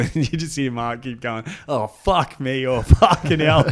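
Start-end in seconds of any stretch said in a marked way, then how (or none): 1.7-3.6 mock fed-up swearing, getting louder; exasperated outburst broken by a laugh; retold exasperation. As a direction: imitate a driver cursing in disbelief. Mimics exasperated muttering while driving.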